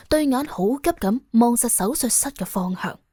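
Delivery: usual speaking voice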